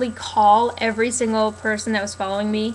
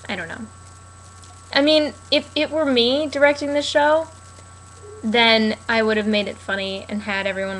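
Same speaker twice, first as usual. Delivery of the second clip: ordinary talk, nothing marked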